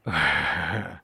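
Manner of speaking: a quick amused laugh; short laugh. Real, not acted. Reader laughing at the spaced-out scam email, a quick laugh. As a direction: laugh at how absurd it is.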